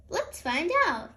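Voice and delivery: ordinary delivery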